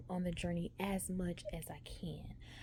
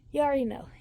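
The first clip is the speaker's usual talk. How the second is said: higher, louder burst of exasperation; exasperated outburst; 6 semitones higher, 10 dB louder, much faster than usual. Retold or imitated. Patient cutting off the doctor, exasperated outburst. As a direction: blurt it out, fed up.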